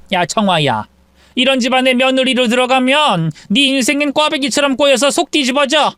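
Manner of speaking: lightly voiced stern mother; scolding mother. Mother lectures her daughter, scolding. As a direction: talk sense into her.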